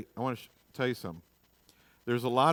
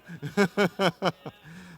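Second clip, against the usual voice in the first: real higher, rolling laugh; 5 semitones higher than usual. Real, not acted. Preacher kidding about a senator, laughing. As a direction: laugh freely at your own joke.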